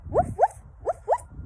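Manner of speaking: high yappy barks; dog barking. A narrator's reading. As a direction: bark like a little dog. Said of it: "barked the little dog".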